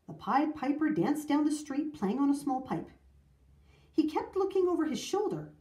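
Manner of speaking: plain reading voice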